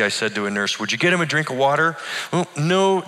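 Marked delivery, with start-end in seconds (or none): 0.8-2.0 hurried, higher ask for water; urgent quick request. A narrator's reading. Pastor urging the nurse for water, quick and pressing. 2.3-3.1 higher, prim refusal; a lightly voiced nurse saying no; nurse's refusal. A narrator's reading. Nurse turning down the pastor, lightly voiced.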